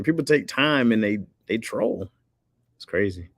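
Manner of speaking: normal talking voice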